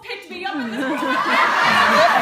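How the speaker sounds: loud laughing through the words; loud laughter. Real, not acted. Teller cracking up at the driver's story, loud and laughing. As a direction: laugh hard as the words come out.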